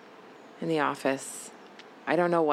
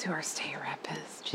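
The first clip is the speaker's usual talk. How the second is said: whispering; 3 semitones lower, 11 dB quieter than usual. Real, not acted. Aside about disliked state rep.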